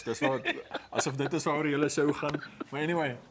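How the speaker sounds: laughing, loud and fired up; excited laughing. Real, not acted. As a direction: burst out laughing, then keep it loud. Host laughs, gets louder, fired up.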